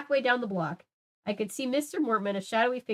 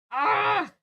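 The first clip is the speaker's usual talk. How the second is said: short, high, loud angry shriek; angry scream; 12 semitones higher, 4 dB louder than usual. Acted. Lucy screams in anger at Aaron. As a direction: let out a furious yelp. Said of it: "I let out an angry scream".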